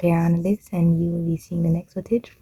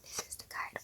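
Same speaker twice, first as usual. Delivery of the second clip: hushed, voiceless whisper; 28 dB quieter than usual. Real, not acted. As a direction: whisper it very softly. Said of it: "Why am I whispering?".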